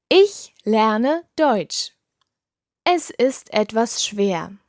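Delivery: slow and careful, every syllable clear; slowly. Shown as a demonstration. Teacher to learners, saying both phrases slowly. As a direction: take it slowly so the whole phrase can be heard. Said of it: "I'll say this slowly".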